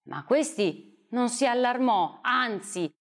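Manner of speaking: ordinary delivery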